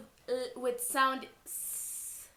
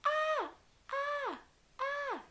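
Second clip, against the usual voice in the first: mock shout; slower than usual. Story children feeling ants, high mock cry. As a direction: play-act the kids crying out. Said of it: "They shout".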